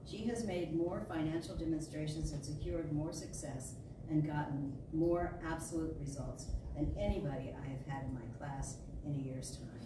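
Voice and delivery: ordinary delivery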